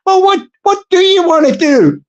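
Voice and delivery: high, pressing question; exasperated council woman. Acted. Council woman pressing Mister Bloggs, high and exasperated.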